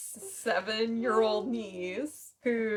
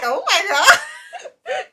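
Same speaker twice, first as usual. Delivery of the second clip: higher, louder, laughing oh my God; laughing disbelief; 12 dB louder than usual. Retold or imitated. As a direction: gasp it out through laughter. Retells shocked reaction.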